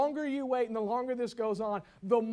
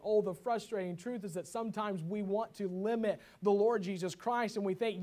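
ordinary talk

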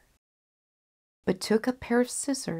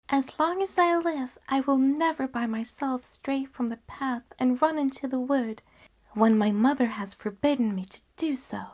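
a higher, lightly voiced girl making a vow; earnest little girl; 6 semitones higher than usual. Girl promising herself never to stray, high and earnest. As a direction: promise it solemnly to yourself.

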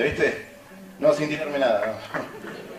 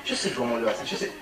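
low, hushed aside to a neighbour; mock confidential murmur; imitated audience whisperer; 8 semitones lower, 11 dB quieter than usual. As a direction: say it very softly, as if to the next seat. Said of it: "le decía a la al lado".

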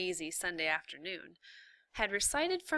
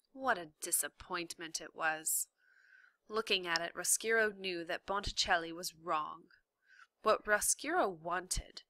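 usual speaking voice

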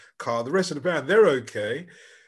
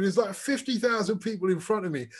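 usual speaking voice

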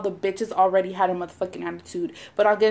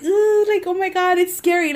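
much higher, a little louder, starstruck jitters; mock nervous squeal; 9 semitones higher, 4 dB louder than usual. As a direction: squeal like a shy fan being recognized. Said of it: "I get, like".

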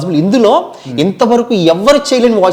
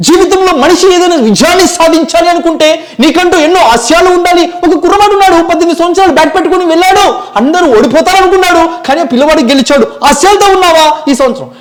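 fiery motivational speaker; 10 semitones higher, 4 dB louder, faster than usual. Retold or imitated. Mimicry run of many voices.

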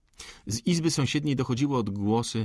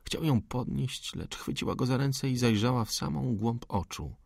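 ordinary delivery

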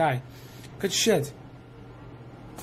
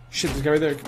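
ordinary delivery

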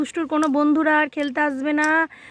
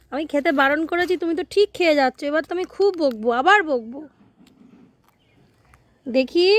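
put-on coaxing a toddler; 2 semitones higher than usual. Mother coaxing her sulking little girl to look up, gently. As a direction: soothe her and win a look back.

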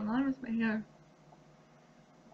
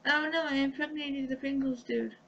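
ordinary talk

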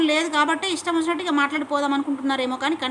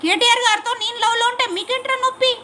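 higher, raised challenge; heated outburst; indignant shout; 7 semitones higher than usual. Real, not acted. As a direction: throw it back at the critics. Furious rant at mocking critics.

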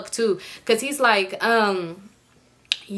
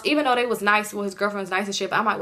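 ordinary talk

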